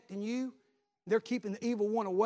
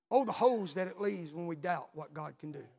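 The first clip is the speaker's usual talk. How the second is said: usual speaking voice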